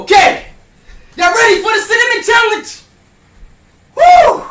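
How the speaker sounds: high, loud hype shout to chat. Real, not acted. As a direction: pump up the crowd with a shout. Hyping up stream before challenge.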